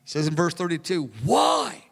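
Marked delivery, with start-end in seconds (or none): 1.2-1.8 protesting cry. Retold or imitated. Jonathan crying out to his father Saul, protesting. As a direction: cry out in disbelief at the king.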